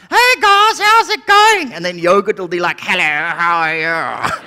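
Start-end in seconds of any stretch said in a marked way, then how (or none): 0.1-1.7 high, loud chirpy milk character. Acted. Milk greeting the fridge, high loud character voice. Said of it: "the milk will be like". 2.8-4.3 low, affected yogurt character; cultured yogurt voice. Acted. Yogurt greeting the others, low cultured voice. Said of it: "Why do you sound like that?".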